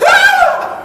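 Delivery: sudden high yelp of surprise, half laughing; startled waking yell. Retold or imitated. Kid jolting awake in the car, startled yelp. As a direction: jolt awake with a laughing shriek.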